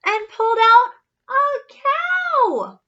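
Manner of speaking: slow, high, loud gasp of wonder; amazed reveal. A narrator's reading. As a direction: marvel at it. Big reveal.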